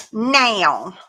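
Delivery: "now" stretched out toward "nayl"; imitated mock-Southern now. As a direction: draw out "now" like "nail", falling. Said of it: "trying to sound Southern".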